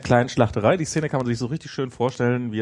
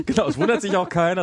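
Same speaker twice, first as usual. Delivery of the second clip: higher-pitched, amused agreement; laughing; 7 semitones higher than usual. Real, not acted. Co-host amused that nobody in the tale wonders, laughing. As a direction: talk through real laughter.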